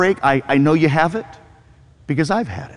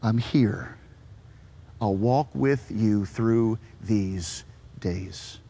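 soft, low, slow reassurance; tender Jesus; 10 semitones lower, 11 dB quieter, slower than usual. Retold or imitated. Jesus reassures the dying Stephen, soft and tender. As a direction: comfort him gently.

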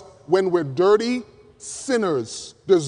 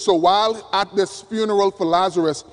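normal talking voice

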